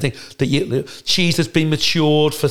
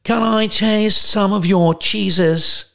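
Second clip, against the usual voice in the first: higher, slow, posh mock character voice; hammed-up higher drawl of a pompous TV host; Brian Badondi; 6 semitones higher, much slower than usual. Brian Badondi asking the cheese manager for a taste, hammed-up and slow. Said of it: "and he goes".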